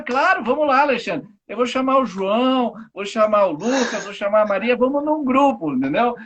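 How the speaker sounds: loud, high, acted-out enthusiasm; eager social type. Social type answers an invitation by gathering friends, eager role-play. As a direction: play someone keenly rallying the whole gang. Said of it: "O social dominante, ele fala".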